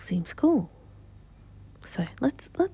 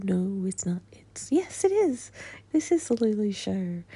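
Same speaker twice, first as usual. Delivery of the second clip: ordinary talk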